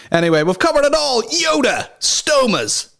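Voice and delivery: high, excited shouty handoff; hyped-up outro. Real, not acted. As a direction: launch into the intro, pumped. Hyped intro handoff, huge pitch jump.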